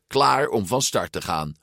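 plain reading voice

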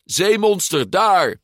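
high, loud alarm cry; screaming. A narrator's reading. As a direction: shriek a warning to everyone. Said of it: "gilde hij".

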